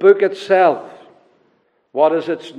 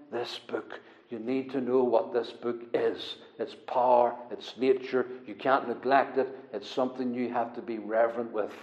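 much softer, low and earnest; hushed reverence; 6 semitones lower, 11 dB quieter than usual. Real, not acted. Preacher urging the congregation to revere the book, hushed. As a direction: drop the voice low, as if in awe.

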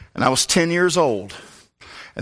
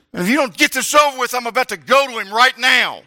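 desperate inner voice; 11 semitones higher, 3 dB louder than usual. Retold or imitated. Boy's inner plea, freezing.

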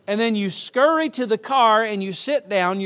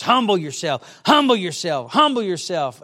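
mocking repeated phrase; 6 dB louder than usual. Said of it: "throwing out these phrases".

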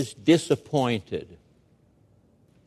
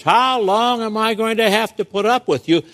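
higher, exasperated barking question; a hint of drill sergeant, fed up with raw recruits; 8 semitones higher, 3 dB louder than usual. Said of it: "Sounds like a drill sergeant".

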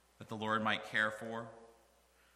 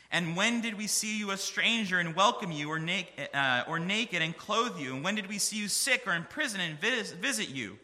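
louder and louder, the verse shouted to the church; full-force preaching; 7 semitones higher, 7 dB louder than usual. Acted. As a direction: build it up like a preacher's climax. Righteous voiced, shouted high.

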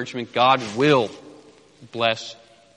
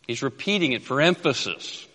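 ordinary talk

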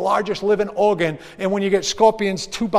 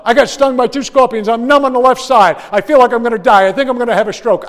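panicked answer; 6 semitones higher, 9 dB louder than usual. Retold or imitated.

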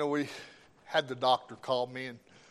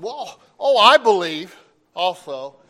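higher, louder mock protest; flustered insistence; 5 semitones higher, 13 dB louder, slower than usual. Son imitates the doctor claiming he believes too, flustered insistence. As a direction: act out a doctor hurriedly insisting.

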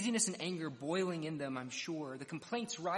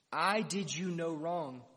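slow and louder, each word weighed; firm rebuke; 6 dB louder, slower than usual. Acted. Master rebuking the grumbling laborer, slow firm loud rebuke.

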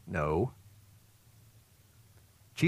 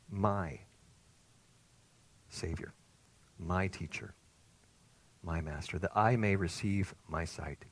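much softer, slow and reverent; tender devotion, softer; 5 semitones lower, 7 dB quieter, slower than usual. Acted. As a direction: murmur it like a prayer. Reverent naming of Jesus.

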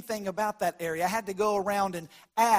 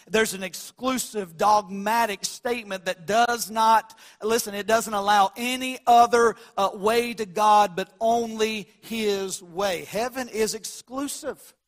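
preaching; 2 semitones higher, 6 dB louder, slower than usual. Real, not acted. Preaching at full force.

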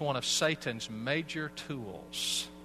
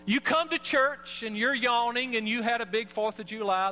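preaching emphasis; 8 semitones higher, 4 dB louder than usual. Real, not acted. Preacher painting the sleepy churchgoer, high and loud.

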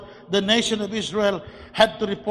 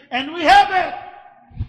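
rising to a full shout, slowing on the claim; preaching shout; 6 semitones higher, 4 dB louder than usual. Real, not acted. Preacher urging the congregation to claim the land, shouting. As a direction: proclaim it like a revival preacher. Preacher proclaiming God's promise.

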